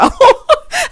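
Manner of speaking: loud, high burst of laughter; high cackle; real laugh. Real, not acted. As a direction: crack up at your own embellishment. Laughs at own mock Spanish.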